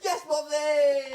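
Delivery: high, loud, drawn-out gloating cheer; mock gleeful cheer. Sibling gloats as mum joins the teasing, gleeful cheer. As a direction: cheer mum on, stretching the words.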